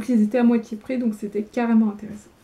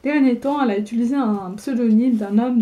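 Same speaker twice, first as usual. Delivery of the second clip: usual speaking voice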